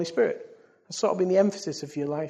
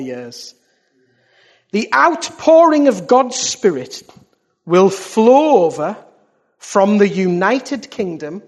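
loud, weighty proclamation; prophetic declamation; 7 dB louder than usual. Real, not acted. Preacher reads an old prophecy to the church, prophetic declamation. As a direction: proclaim each phrase, pausing between them. Continues reading the prophecy with force.

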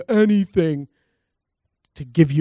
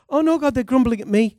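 whiny Moses; 8 semitones higher than usual. Retold or imitated. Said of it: "Moses said".

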